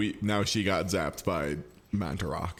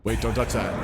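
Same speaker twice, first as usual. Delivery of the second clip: normal talking voice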